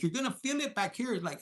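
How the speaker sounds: usual speaking voice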